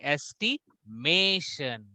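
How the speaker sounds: "estimation" split, third syllable MAY held long; "estimation" slowly, weight on MAY; stress on MAY. Retold or imitated. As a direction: repeat "estimation" with a pause before MAY. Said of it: "so Shubhra is saying".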